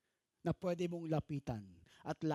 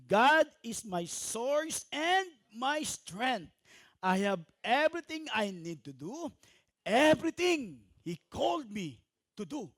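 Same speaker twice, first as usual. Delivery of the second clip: congregation reading; 5 semitones higher, 8 dB louder than usual. Acted. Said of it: "Pakibasa, sabay-sabay".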